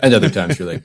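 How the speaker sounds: usual speaking voice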